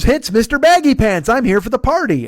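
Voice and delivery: high hammed-up party clown; goofy clown greeting. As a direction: chirp it like a birthday clown at the door. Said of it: "who then goes".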